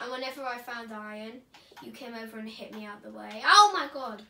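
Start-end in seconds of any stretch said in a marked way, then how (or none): 3.4-4.2 indignant, louder and higher; loud shout. Real, not acted. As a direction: yell it in outrage. Shocked outburst in argument.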